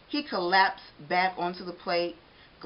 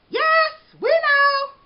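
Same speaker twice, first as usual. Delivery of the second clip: high, loud, slow chorus shout; shouting back; 12 dB louder, much slower than usual. A narrator's reading. The donuts answer the baker from the shelves, high loud shout. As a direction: shout it back cheerfully as a crowd. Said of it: "the donuts shouted back".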